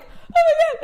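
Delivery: very high, loud mock squeal; Dean. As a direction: shriek it in hammed-up joyful disbelief. Acting out Dean seeing Castiel again.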